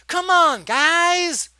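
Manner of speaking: high, loud, drawn-out appeal; exasperated pleading. Real, not acted. Reviewer begging filmmakers to use their brains, exasperated pleading. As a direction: stretch each plea in exasperation.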